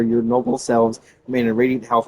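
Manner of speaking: ordinary delivery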